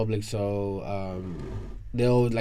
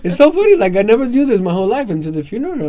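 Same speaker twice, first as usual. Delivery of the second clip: high, loud and amused; excited retelling; 11 semitones higher, 11 dB louder, faster than usual. Real, not acted. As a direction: tell it laughing in disbelief. Laughing at the memory.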